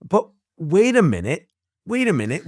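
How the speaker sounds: mock double-take; sudden realization. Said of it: "I'm like".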